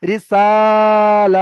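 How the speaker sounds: রিসালাতি with সা held long, রি and তি short; slow Quranic drawl on সা; long drawn vowel. Shown as a demonstration. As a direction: stretch only the সা of রিসালাতি. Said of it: "এখানে আমি টান দেব না".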